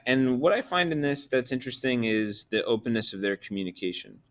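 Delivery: normal talking voice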